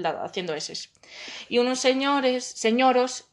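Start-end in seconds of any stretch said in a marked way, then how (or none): none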